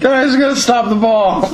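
high voice through laughter; laughing. Retold or imitated. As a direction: say it while cracking up. Mock-voicing the goalie, laughing.

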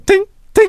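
high-pitched little metallic tinks; imitated tinking. Attackers trying to break in, imitated high tinking. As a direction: mimic spaced taps of a tool on stone. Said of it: "And then I just hear tink".

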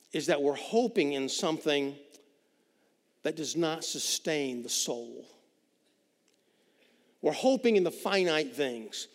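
ordinary delivery

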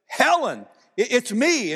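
a joyful retold cry of recognition; excited recognition. Woman recognizing her old friend, called out excitedly.

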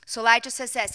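ordinary talk

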